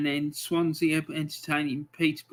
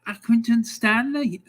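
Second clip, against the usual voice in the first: higher, mock Scouse voice for Accrington Stanley; terrible Scouse accent; 5 semitones higher than usual. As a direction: ham up a bad Liverpool accent on Accrington Stanley. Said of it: "my terrible Scouse accent".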